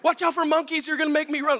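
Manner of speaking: high-pitched comic panicked shout; re-enacted yelling from the camel's back; retold yelling. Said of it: "I'm yelling at him right here".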